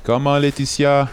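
calling out high across the house; yelling across the room. Acted. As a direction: shout her name from another room. Husband calls wife to dinner.

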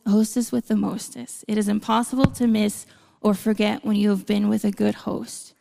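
ordinary talk, nothing marked

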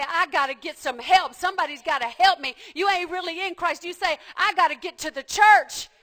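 loud, pleading shout of someone in crisis; desperate cry for help. Acted. Preacher acting out a desperate seeker, shouting. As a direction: cry out like you need help now.

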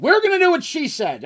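high, loud, emphatic surrender; mock bold declaration. Husband giving in to the woman in charge, mock bold and loud. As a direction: proclaim the climbdown as if it were your own order. Boss loudly yielding to her decision.

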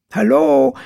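high, loud call across the pool. A narrator's reading. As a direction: call hallo across the yard. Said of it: "rief Alexa".

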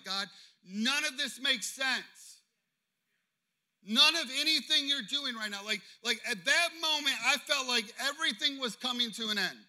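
loud, high, exasperated sermon delivery; impassioned preaching. Acted.

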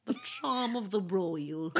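slow, soft and regal; a hint of a stately princess; royal voice. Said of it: "you've had so many royal voices".

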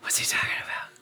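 confused whisper to a friend. Retold or imitated. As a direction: whisper it, puzzled. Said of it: "whispering to each other".

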